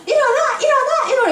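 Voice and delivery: high, loud imitated yell of a mother; imitating a mother yelling at sleeping kids; mock wake-up yell. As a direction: shout the kids awake, hammed up. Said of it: "이렇게 한 적 없어요".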